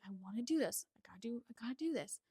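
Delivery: whispering a pep talk to herself; whispered self-talk. Retold or imitated. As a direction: urge yourself on in a whisper. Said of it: "But I was like".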